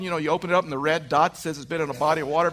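ordinary talk, nothing marked

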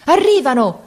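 high, ringing shout of news; very high cry. A narrator's reading. Sergeant coming down from the attic, very high cry. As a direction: cry it out at the top of the range. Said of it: "gettò un grido altissimo".

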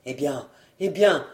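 low, hesitant faltering; low, halting evasion; laboured stammering. Acted. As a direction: stumble for words while stalling. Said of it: "ânonnait péniblement le collectionneur".

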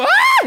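very high, loud yelp; hammed-up high scream; startled mock scream. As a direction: shriek as if a spider touched you. Retold spider crawling up arm.

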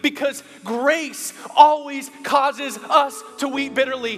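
"always" stretched very slowly and hammered loud; slow, loud preacher's punch line; drawn-out emphasis. Acted. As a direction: lean hard on every word of it.

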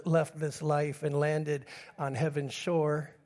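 quiet tender remembrance; tender, hushed. Real, not acted. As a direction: speak it softly, like at a bedside. Tender memory of friend's father.

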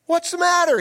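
high, loud concerned wife; a sharp wife's question; Jezebel asking. Acted. Wife asking her sulking husband, high and sharp. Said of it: "his wife Jezebel asked him".

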